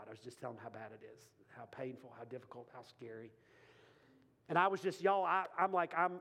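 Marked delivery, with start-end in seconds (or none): 0.1-3.3 hushed and heavy. Real, not acted. Describing hardship in prayer.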